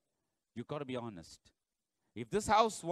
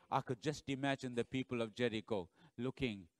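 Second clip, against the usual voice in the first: normal talking voice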